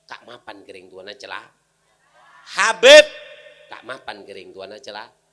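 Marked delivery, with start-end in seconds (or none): 2.5-3.0 explosive high shout on one word; preacher's sudden cry. Acted. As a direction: blast the name out suddenly. Preacher bursts out mid-refrain.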